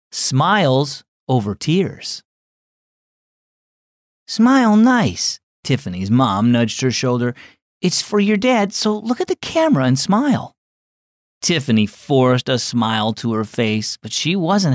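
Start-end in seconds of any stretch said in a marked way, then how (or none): 4.3-5.4 slow, high coaxing mother's voice; coaxing mom. Acted. Mom coaxing daughter for photo. 7.8-10.5 higher, lightly voiced mother urging; coaxing mom. Mom coaxing daughter for photo.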